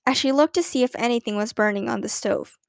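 plain reading voice

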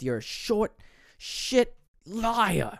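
high, spat-out final insult; imitating a furious parting shot. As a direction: throw the last insult at him. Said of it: "he ends it with".

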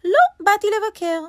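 high, loud little rabbit protesting; cross rabbit. A narrator's reading. Rabbit refuses lion's welcome, cross and high. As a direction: snap back in a high rabbit voice.

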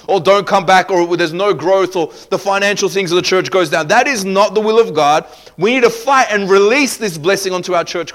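loud, high, urgent preacher voice; full-force preaching. Real, not acted. Preacher urging the church to take authority, full-force preaching.

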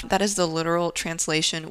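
ordinary talk

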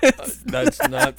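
laughing through the words, slowly; can barely get it out for laughing. Real, not acted. Reacting to the claw story, laughing through it.

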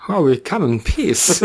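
a gray alien doing Christopher Walken. Pretend. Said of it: "Are you trying to be Christopher Walken?".

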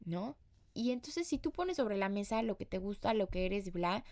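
ordinary delivery

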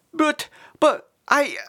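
high, panicky stutter; bewildered, broken false starts; stammering. A narrator's reading. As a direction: stammer helplessly, pitched right up. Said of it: "Sid stammered".

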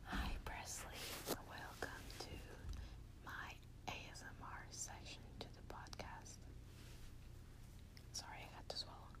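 very soft, breathy whisper; ASMR whisper. Real, not acted. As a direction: whisper close for someone falling asleep.

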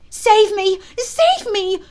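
high, loud plea for rescue; desperate cry. A narrator's reading. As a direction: cry out for help in a panic. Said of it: "cried Nibs".

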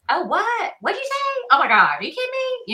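high, excited Southern twang, hammed up; Southern phone chatter. Chatting on the phone with her sister, in an exaggerated Southern accent. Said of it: "you know who I'm talking to 'cause it's like".

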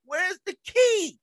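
mother shouting slowly; mock angry mother; high slow demand. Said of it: "She goes".

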